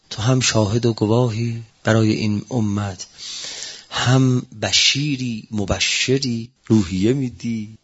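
low, slow reverent preaching; deep sermon recitation. Acted. Preacher reciting the verse to the faithful, low slow reverence. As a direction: intone it low and unhurried.

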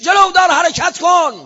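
high, loud shouted order to the army; commanding battle cry. Retold or imitated. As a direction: bellow the command to march. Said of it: "یه فریاد کشید". (فرمان بلند و زیر با فریاد)

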